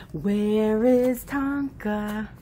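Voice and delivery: low, soft, drawn-out question; slow singsong. Real, not acted. Parent asking baby where Tonka is, slow soft singsong.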